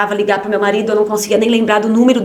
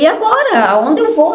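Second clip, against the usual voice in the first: high, louder, lost and pleading; desperate plea; 7 semitones higher, 4 dB louder than usual. Retold or imitated. Mother begging the pediatrician for somewhere to go, desperate and high. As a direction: cry out not knowing where to turn. Doctor says she cannot refer.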